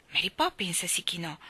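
usual speaking voice

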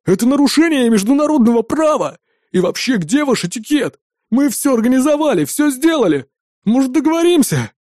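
high, agitated complaining; exclaimed protest. A narrator's reading. Terrorist complaining to his captor, agitated exclamation. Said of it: "воскликнул один из террористов".

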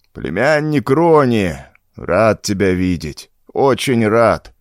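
put-on slow, creaky old man voice. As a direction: creak it out like a frail old uncle. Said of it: "проскрипел старик".

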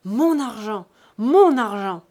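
loud, drawn-out furious cry; explosive indignant shout; angry outcry. A narrator's reading. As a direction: roar it in outrage. Furious son demanding his money.

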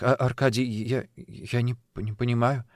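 halting, stammered confusion; flustered. Acted. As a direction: stumble over the words, lost. Flustered by the proposal question.

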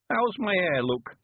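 put-on high, slow creaky old countryman; old man voice. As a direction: play a cheerful old villager.

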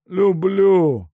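high, loud agonised moan. Acted. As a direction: groan it out in pain. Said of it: "простонал Сенька". (простонал от боли)

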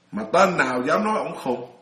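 normal talking voice

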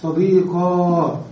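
صديقا drawn out slowly, ending in a long a; صديقا with the ya swapped for a long alif. Shown as a demonstration. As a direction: stretch صديقا into a slow "sadiqa". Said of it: "نبدل هذه الياء ألفا".

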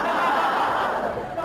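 rising, voiceless burst of laughter; breathy laugh. Acted. The emperor laughs at his own trick on Ji Xiaolan, a rising voiceless laugh. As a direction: let a gleeful laugh rise out of you.

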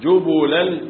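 جبلا said jubulan, damma on jim and ba, light lam; jubulan reading. Shown as a demonstration. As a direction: say جبلا as ju-bu-lan. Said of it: "بضم الجيم والباء وتخفيف".

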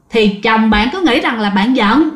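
ordinary delivery

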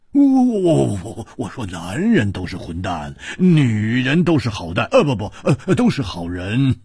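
panicky, comic placating stutter; flustered backtracking. Acted. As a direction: scramble to correct yourself. Flustered man backtracks before angry princess.

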